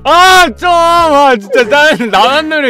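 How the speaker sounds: high, loud wail of protest; aggrieved whining. Real, not acted. Player complaining everyone targets them, aggrieved wail. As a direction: protest that it is unfair, loudly.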